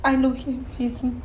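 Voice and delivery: soft voice dying away; hushed confession. Real, not acted. Wife admitting who the father is, hushed confession. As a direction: admit it barely audibly.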